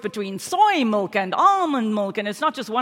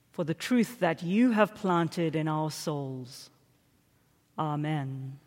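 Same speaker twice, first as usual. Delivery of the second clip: low, soft, slow-paced prayer; opening prayer; 4 semitones lower, 6 dB quieter than usual. Real, not acted. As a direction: lead the congregation gently into prayer.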